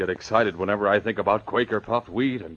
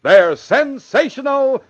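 overexcited hype; 7 semitones higher, 7 dB louder than usual. Acted. Announcer forgetting himself over cereal, building to a yell. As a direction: pitch building to a high, loud shout. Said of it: "There you go again".

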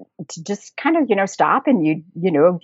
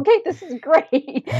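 delighted; 10 semitones higher than usual. Retold or imitated. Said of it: "I was like".

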